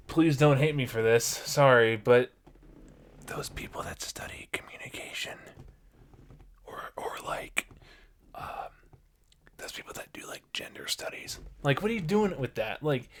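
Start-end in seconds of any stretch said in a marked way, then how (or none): 3.3-11.4 hushed, teasing whisper; whispered jab. Real, not acted. Host whispering a cheeky dig at gender studies, hushed whisper. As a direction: whisper it like a guilty confession.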